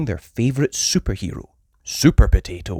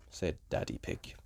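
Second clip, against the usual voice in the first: usual speaking voice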